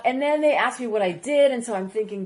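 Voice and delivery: normal talking voice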